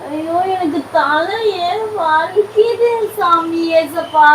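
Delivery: slow pained groan. Real, not acted. Wife moaning about her headache to husband, slow groan. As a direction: moan it out slowly, head aching.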